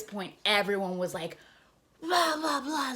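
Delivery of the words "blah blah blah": high-pitched mock clamor; hammed-up yelling blahs; imitated crowd yelling. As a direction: babble like a riled-up crowd. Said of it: "like, yelling and staring at me".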